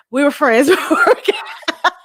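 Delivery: breaking up laughing mid-sentence; real laughter. Real, not acted. Wife admits they were friends only briefly, cracking up laughing. Cracks up at own two-days admission.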